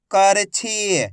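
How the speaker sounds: करछी read out with a long ई; drawn-out long ई. Shown as a demonstration. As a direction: stretch the final छी of करछी. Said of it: "खींच के पढ़ा जाता है".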